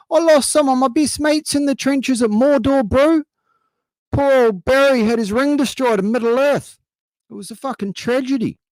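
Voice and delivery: put-on very high-pitched, caricatured New Zealand accent; hammed-up, squeaky-high Kiwi war lament; Kiwi veteran impression. Kiwi veteran reminiscing at the veterans club, mock-tragic New Zealand accent. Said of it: "young guys sitting around going".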